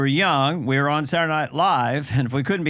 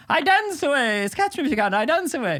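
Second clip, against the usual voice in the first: higher, haughty dancing prince; prince catchphrase; 11 semitones higher than usual. Retold or imitated. A prince teasing the artist trying to paint him, high haughty sing-song. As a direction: taunt the painter as you prance off. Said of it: "I was dancing all around".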